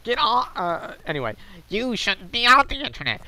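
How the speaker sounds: pinched, nasal, with the nostrils held shut; nose-plugged voice. Acted. Reading Mister Happy's post aloud while holding his nose. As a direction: read it holding your nose like a smug poster. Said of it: "I should be plugging my nose".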